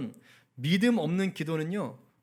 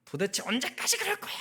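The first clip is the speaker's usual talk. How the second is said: mock exasperated scolding, pitched higher; hammed-up high scolding; imitated angry outburst; 7 semitones higher than usual. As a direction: act out a fed-up parent snapping at family. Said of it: "입이 확 열리면서".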